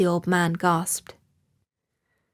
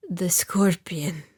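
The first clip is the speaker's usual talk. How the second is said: weak whisper; much slower than usual. A narrator's reading. Dying old man whispers.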